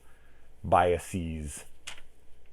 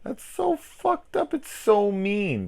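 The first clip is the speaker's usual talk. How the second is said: much higher, slow, squealing "It's so mean"; mock outrage; 6 dB louder, slower than usual. Real, not acted. Reacting to the master hanging up on his fan, squealing in mock shock.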